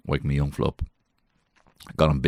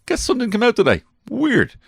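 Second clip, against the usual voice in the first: dopey deadpan; 5 dB louder than usual. Pretend. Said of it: "I'll be like".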